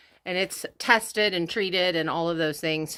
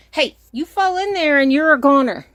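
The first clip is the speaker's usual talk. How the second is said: raised, higher-pitched warning to a pet; scolding warning; 7 semitones higher, 6 dB louder than usual. Real, not acted. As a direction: call out sharply to warn them off. Warning an animal off the opening.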